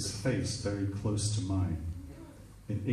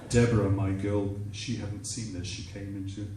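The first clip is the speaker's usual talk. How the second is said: ordinary talk, nothing marked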